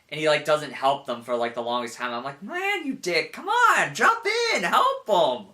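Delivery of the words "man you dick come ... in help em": high-pitched mock pleading with the hero; exasperated urging. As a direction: urge him into the fight, exasperated. Yelling at hero who won't help.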